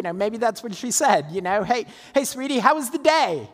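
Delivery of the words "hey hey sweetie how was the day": higher, eager wife's greeting; a lightly voiced eager Sarah; Sarah asking eagerly. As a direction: play the curious wife, pitched up.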